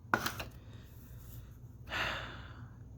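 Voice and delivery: long, tired, whispery sigh; drawn-out exhausted sigh; heavy sigh. Real, not acted. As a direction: let out a long, worn-out breath. Alone, weary, needs a Xanax.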